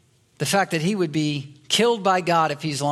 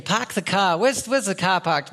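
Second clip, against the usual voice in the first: mock Boston accent with dropped Rs; Bostonian accent. Preacher shows what his kids didn't say, Bostonian accent. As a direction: drop the Rs like a Bostonian. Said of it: "they didn't give the Bostonian".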